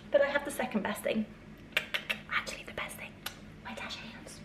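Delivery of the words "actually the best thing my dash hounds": whispering. Real, not acted. Whispered aside.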